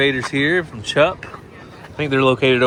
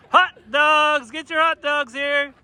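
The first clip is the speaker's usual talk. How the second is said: emphatic vendor call; 12 semitones higher, slower than usual. Shown as a demonstration. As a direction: belt it like a ballpark seller. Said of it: "I want a little more emphasis".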